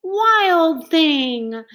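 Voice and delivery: high scolding call of wild thing; a mother's sharp call. Acted. Mother scolding her boy, calling him wild thing. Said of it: "His mother called him".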